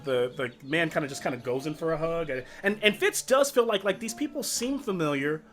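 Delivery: ordinary talk, nothing marked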